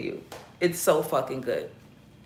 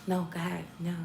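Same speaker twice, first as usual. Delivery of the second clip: quiet mock apology over the mic; hushed, fumbling back and forth; a hint of a flustered rapper, softly; mimicking forty, hushed; 10 dB quieter, much faster than usual. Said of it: "So forty's like".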